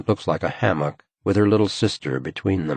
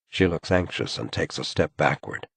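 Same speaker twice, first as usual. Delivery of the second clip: usual speaking voice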